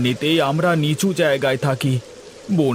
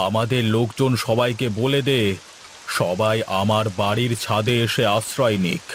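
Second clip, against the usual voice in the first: lower, heavier landlord voice; zamindar voice; 6 semitones lower than usual. Acted. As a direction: speak down as the village landlord. Same actor voices the zamindar.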